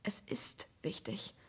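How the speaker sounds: slow, pressed whisper through gritted teeth. Acted. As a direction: force it out through clenched teeth. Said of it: "bringe ich zwischen zusammengepressten Zähnen hervor".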